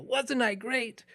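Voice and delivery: high, drawn-out mock yell; imitated yell. Imagining boasting from the back of the chapel, mock yell. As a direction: re-enact a child's boastful yell, not at full volume. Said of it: "at the back of this chapel and yell".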